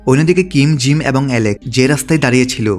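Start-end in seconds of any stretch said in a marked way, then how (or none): none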